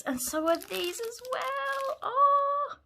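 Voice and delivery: high-pitched delighted squeal; overwhelmed delight. Real, not acted.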